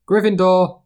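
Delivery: high, ringing announcement of the house; Sorting Hat call. A narrator's reading. The hat calling out the new student's house.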